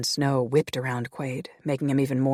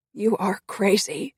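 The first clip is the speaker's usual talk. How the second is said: breathless, pitched up and slow; gasped; 7 semitones higher, slower than usual. A narrator's reading. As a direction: gasp it out after the fall. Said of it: "she gasped".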